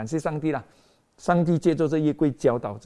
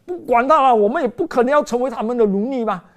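defiant soldiers; 8 semitones higher, 6 dB louder than usual. Acted. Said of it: "但是他们讲".

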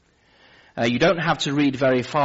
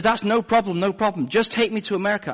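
higher, quick, pleading nurse voice; pushy nurse; 10 semitones higher than usual. Retold or imitated. Nurse begging the patient to take her along, eager. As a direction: brush off the wife and beg, pitched up.

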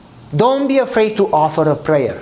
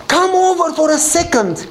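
insistent calling; 10 semitones higher than usual. Retold or imitated. Neighbor coaxing a hesitant man over, insistent calling. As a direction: call him over, pitched well up.

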